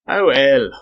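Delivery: loud exasperated outburst; exploding. A narrator's reading. Other Englishman fed up with Esteban's act, exploding. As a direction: burst out with it impatiently. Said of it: "exploded the other Englishman".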